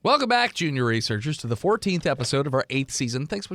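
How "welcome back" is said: high, loud show host opener; announcer intro. Acted. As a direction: greet the audience like a host.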